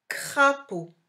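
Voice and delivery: Crapaud said high, loud and slowly articulated; clear dictation voice. Shown as a demonstration. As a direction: call out Crapaud crisply for a child. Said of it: "Je vais nommer les dessins". (prononcé haut et fort, bien articulé pour la dictée)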